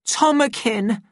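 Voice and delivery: high, plaintive woman's cry; high emotional outcry; desperate appeal. A narrator's reading. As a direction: call the beloved name imploringly. Linda runs to embrace him.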